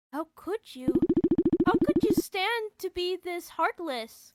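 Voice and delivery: wounded fury; accusing. Acted. Galacta accuses him of heartlessness, hurt anger.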